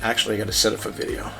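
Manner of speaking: ordinary delivery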